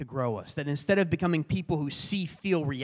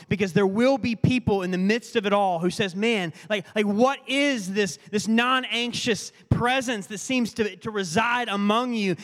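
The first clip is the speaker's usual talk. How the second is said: high, loud, driving sermon build; impassioned preaching; 6 semitones higher, 5 dB louder than usual. Real, not acted. Pastor voicing people amazed at a non-anxious presence, impassioned. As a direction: preach the onlookers' wonder with intensity.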